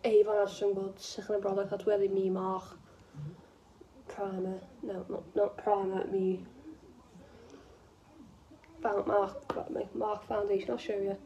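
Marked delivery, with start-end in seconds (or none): none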